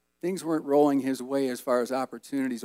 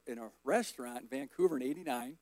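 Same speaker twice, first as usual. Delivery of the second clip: ordinary delivery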